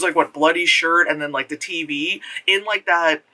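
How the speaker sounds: ordinary talk, nothing marked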